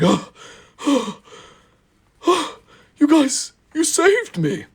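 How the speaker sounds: high, breathless, overcome with thanks; gasping gratitude. Acted. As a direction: gasp like a sentimental engine.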